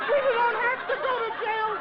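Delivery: high, loud giddy squeal. Acted. Repeated louder.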